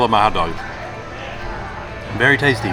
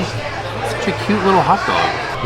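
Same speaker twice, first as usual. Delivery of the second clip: cutesy cooing; 7 semitones higher, much slower than usual. Real, not acted. Cooing over a tiny hot dog.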